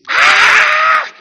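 high, loud martial-arts yell; piercing fighter's battle cry; karate scream. Retold or imitated. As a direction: let out a sharp karate kiai. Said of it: "And then he'd scream".

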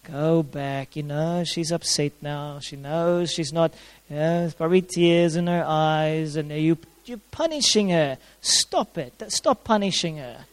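a quiet, loving nudge to go back and make it right; gentle Holy Spirit. Retold or imitated. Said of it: "gentle Holy Spirit coming and saying".

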